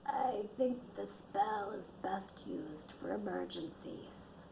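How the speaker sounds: put-on slow, soft, hoarse old-crone rasp. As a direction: croak it like an old smoker. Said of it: "Jenna's hoarse voice ... like an old crone".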